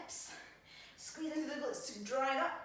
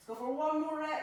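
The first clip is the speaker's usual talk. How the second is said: louder, higher push for one more; encouraging call; 5 semitones higher, 6 dB louder than usual. Real, not acted. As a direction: urge them through the last rep. Coach pushing the final rep.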